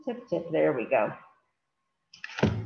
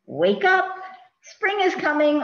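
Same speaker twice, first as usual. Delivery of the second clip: lively wake-up call; 9 semitones higher, 6 dB louder than usual. A narrator's reading. Reading the book's bear wake-up line.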